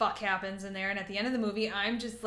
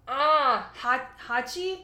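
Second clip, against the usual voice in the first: normal talking voice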